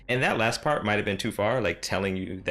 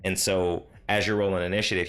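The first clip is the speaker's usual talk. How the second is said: usual speaking voice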